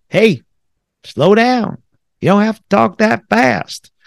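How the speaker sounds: hammed-up Southern accent telling someone to slow down; Southern drawl. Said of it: "I'm using the Southern drawl, probably a lousy one".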